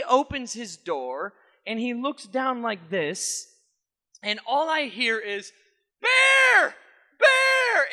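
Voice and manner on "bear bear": screamed name, much higher and louder; a friend screaming for his trapped dog; panicked shout. Retold or imitated. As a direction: yell the dog's name in panic. Friend discovers dog under tire.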